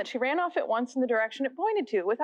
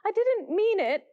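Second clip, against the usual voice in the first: put-on higher, slow pleading little girl; pleading Alice; 9 semitones higher, 4 dB louder than usual. A narrator's reading. Alice pleading with the offended mouse, high and slow. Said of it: "pleaded poor Alice".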